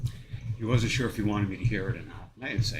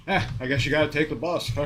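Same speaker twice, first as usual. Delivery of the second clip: mock shrug, quicker and higher; higher-pitched, offhand dismissal in mockery; apathetic person; 5 semitones higher, 4 dB louder, faster than usual. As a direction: imitate someone brushing it off. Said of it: "to the apathetic, it would be".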